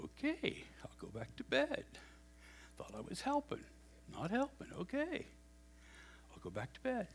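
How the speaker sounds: dejected whisper. Retold or imitated. Boy slinks back dejected.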